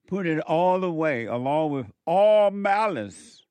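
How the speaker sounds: loud, emphatic command with ALL hit hard; preaching shout. Real, not acted. Preacher urging congregation to drop malice, loud command. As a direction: proclaim it with force.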